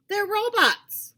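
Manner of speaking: put-on the sausage boy states the obvious; smug boy's voice. A narrator's reading. Sausage boy, slow loud voice.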